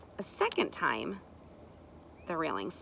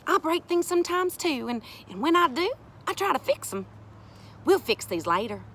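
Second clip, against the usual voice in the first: higher, lilting reassurance; reassuring woman character; 8 semitones higher than usual. Acted. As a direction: make light of the mishap. Sari reassures the children.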